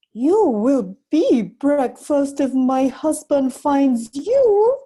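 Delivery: slow, loud, high spooky warning; giant woman warning. Acted. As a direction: scare the boy with a looming threat. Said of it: "she told Jack".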